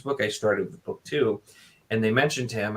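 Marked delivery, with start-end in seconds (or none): none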